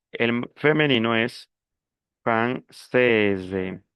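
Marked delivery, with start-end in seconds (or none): 2.2-3.8 "française" with a long è and voiced z ending; sounded S. Shown as a demonstration. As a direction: draw out "française", sounding the final s as z. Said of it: "Cambia también la pronunciación". ("française" pronunciando la s)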